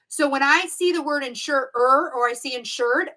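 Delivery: usual speaking voice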